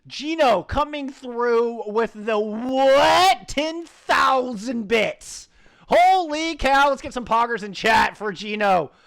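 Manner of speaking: loud, high, slow stretched hype voice; hype announcer. Real, not acted. Caster thanking Gino for ten thousand bits, hyped. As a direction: roar the shout-out like an arena announcer. Caster hyping a big donation.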